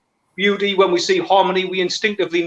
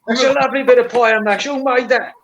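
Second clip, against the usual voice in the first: cheery Geordie voice for the quoted diner, a touch higher; Geordie accent; 5 semitones higher than usual. Acted. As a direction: praise the pie like a Geordie geezer. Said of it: "is that Geordie accent".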